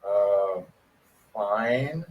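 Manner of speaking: hesitant, low reply; halting, thrown-off answer; mock confused. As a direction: answer warily after a pause. Confused reply to the cold caller.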